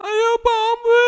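high, loud panicked cry of alarm; scared shout. Acted. Child spots a snake in the tent, panicked loud shout. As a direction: yell it like you just saw a snake.

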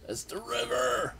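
sudden high alarmed cry; alarmed realization. Real, not acted. Gamer spotting enemies, alarmed high cry. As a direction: spot the danger and yelp it out.

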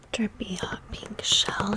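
ordinary talk, nothing marked

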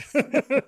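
sudden high, loud laugh; burst of laughter. Real, not acted. Laughing hard with cohost about beers they botched.

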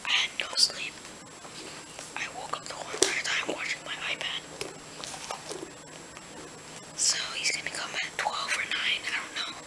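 hushed whisper so family sleeps; whisper, trying not to wake anyone. Real, not acted. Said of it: "I'm whispering 'cause, uh, my family is actually sleeping".

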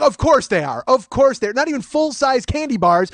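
loud, high sarcastic disgust; exasperated outburst. Retold or imitated. Man hearing they're fun size, loudly exasperated. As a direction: throw it back loudly, fed up.

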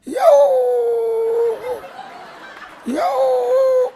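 high, drawn-out whining yelp; stretched, high puppy-like whimper; dog whine. Acted. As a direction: whine like a small dog begging. Female dog whines to husband.

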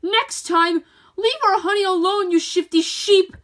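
high, loud, threatening bellow; bellowing queen. A narrator's reading. Queen bee warning the sheep, loud and high. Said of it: "Queen Beatrice bellowed".

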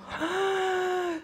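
high, breathy imitated gasp; mock shocked gasp. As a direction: imitate the stunned director gasping. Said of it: "la mina se quedó como". (jadeo de sorpresa imitado)

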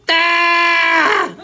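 a loud, high comic scream; hammed-up scream for laughs. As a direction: let out a big shriek. Sudden joke scream.

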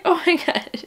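high, overwhelmed half-laugh, half-cry; tearful. Real, not acted. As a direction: choke up happily on a high voice. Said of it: "I'm crying".

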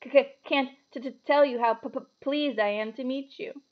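slow, nervous stutter on first consonants; stammering. A narrator's reading. Nervous professor pleased to meet Harry, stammering. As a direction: stumble repeatedly on "C-c-, can't", "t-t-tell", "p-p-pleased". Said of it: "stammered Professor Quirrell".

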